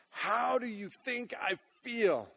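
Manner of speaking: loud, high anguished cry; yelled out. Pretend. Believer yelling at Jesus in pain, loud anguished cry. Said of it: "wants to yell out".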